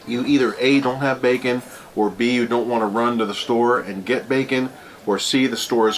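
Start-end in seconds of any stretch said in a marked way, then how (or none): none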